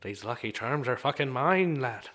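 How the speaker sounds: possessive Irish leprechaun; Irish accent. Acted. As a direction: stake your claim in an Irish brogue. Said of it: "And that's my Irishman".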